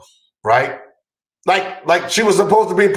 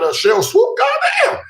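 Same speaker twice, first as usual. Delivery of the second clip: squeaky high starstruck exclamation; high awed squeal. Retold or imitated. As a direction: voice shooting up in awe. Excited retelling seeing Sheryl.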